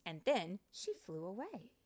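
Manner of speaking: plain reading voice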